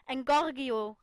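loud spell cry; called out spell. Acted. Potter calls out a spell at the mistletoe. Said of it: "rief er".

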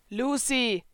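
lightly voiced boy calling a name; Natsu calling Lucy. A narrator's reading. Natsu calling Lucy as she eats apples.

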